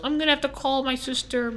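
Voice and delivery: put-on high old lady voice; old lady customer. Old lady explains she must phone family, high voice.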